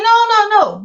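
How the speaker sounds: a mock-loud no to the husband; firm refusal. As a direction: refuse loudly, half laughing. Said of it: "I was like".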